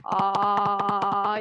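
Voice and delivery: open-jaw ah sound; saying ahh. Shown as a demonstration. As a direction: let the jaw drop on ah. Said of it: "make an ah sound".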